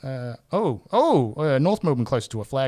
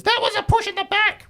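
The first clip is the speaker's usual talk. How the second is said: much higher and louder, indignant yell; outraged shout; 8 dB louder than usual. Shown as a demonstration. Host reading an angry fan comment, shouted. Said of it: "with four exclamation marks".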